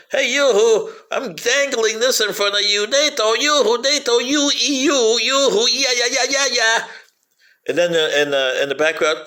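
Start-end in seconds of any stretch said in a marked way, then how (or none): 0.1-6.8 higher, teasing sing-song; mock come-hither tone of the West; taunting West. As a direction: dangle the bait and tease. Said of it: "the West is telling them".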